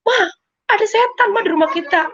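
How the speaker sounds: high, insistent alarmed call; retold panic. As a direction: re-enact warning mom of a ghost. Repeating the ghost alarm to mother. (menirukan seruan panik ke mama)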